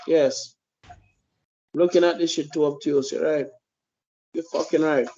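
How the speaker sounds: ordinary delivery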